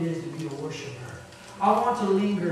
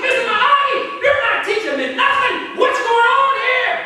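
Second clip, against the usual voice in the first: mock frustrated complaint; 11 dB louder than usual.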